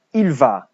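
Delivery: "Il va" clear, eel VAH; model for repetition. Shown as a demonstration. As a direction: say "Il va" for pupils to echo. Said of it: "so you practice the pronunciation".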